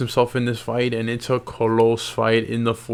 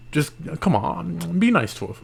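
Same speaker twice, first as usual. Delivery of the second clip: pleading; 6 semitones higher than usual. Real, not acted. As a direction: plead with the viewers. Begging viewers to subscribe.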